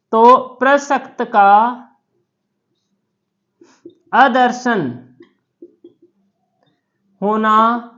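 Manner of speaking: each phrase set apart by long pauses; teacher dictating word by word; slow dictation. Shown as a demonstration. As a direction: spell out the definition slowly for notes. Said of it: "teacher spelling out definition".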